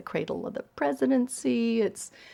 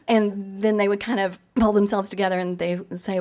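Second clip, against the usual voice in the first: usual speaking voice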